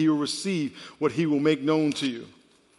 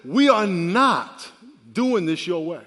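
very slow, louder, each word weighed; slow firm declaration; 4 dB louder, slower than usual. Retold or imitated. Grandfather firmly telling grandson who's boss. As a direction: lay down the law. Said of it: "and I said".

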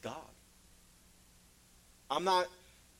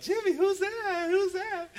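very high, drawn-out mock-mother voice; panicky mom; 13 semitones higher, slower than usual. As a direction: squeal it like an alarmed mother. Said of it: "his mom would be like".

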